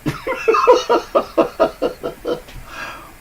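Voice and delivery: loud high laugh; high breathy laugh. Real, not acted. Laughing after birthday joke.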